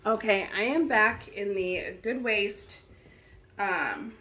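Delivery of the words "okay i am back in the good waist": ordinary delivery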